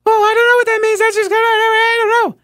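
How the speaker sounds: loud, very high mocking squeal; Philip Bump impression. Host imitating columnist dodging the text message question, squeaky mocking impression. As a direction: caricature a flustered dismissal in falsetto. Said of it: "Philip Bump says".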